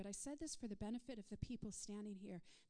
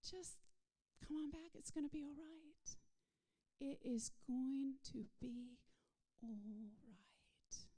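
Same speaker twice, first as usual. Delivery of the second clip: tender reassurance; 4 semitones higher, slower than usual. Acted. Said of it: "And he says".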